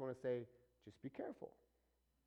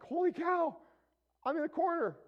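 much higher, louder mock alarm; mock shock; 11 dB louder than usual. Preacher imagining himself panicking in the corner, mock alarm. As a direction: cry out in hammed-up disbelief. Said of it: "Looking back".

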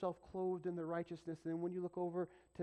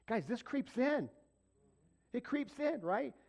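high, loud, slow warning; fervent preacher's warning; impassioned warning; 10 semitones higher, 6 dB louder than usual. Real, not acted. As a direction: sound the alarm from the pulpit. Preacher warns the congregation.

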